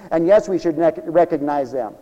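normal talking voice

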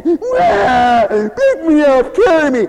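high, loud wailing crybaby voice; bawling baby. Retold or imitated. Preacher mocking a lazy churchgoer as a wailing baby. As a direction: wail it like a spoiled toddler demanding to be held. Preacher mimics a crying child.

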